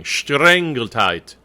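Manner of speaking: "streng geteilt" slow, starting SHT; sh at the beginning. Shown as a demonstration. Coach showing the choir, "streng" begun with sh. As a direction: draw out "streng geteilt" with initial shtr. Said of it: "S T should have a sh at the beginning".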